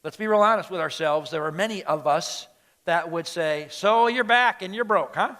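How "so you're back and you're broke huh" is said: louder, higher, accusing welcome; scolding dad's jibe; harsh father. Acted. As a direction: confront the returning son with a jab. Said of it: "that seemed a little harsh".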